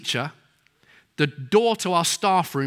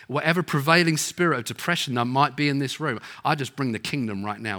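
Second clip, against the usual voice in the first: softer, hushed intercession, fading at the end; a preacher modelling a quiet prayer for the congregation; 5 semitones lower, 5 dB quieter than usual. Acted. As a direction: pray over the room gently. Leads a prayer.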